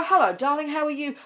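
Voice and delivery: high, sugary welcome home; sweet greeting. Retold or imitated. Wife greeting her late husband at the door, sweetly high.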